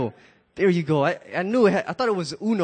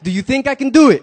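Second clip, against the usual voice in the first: rallying cry to the crowd; loud call; 7 semitones higher, 10 dB louder than usual. Acted. Acrobat challenging the crowd, loud shout. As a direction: shout the question high to rouse them. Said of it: "acrobat asks crowd".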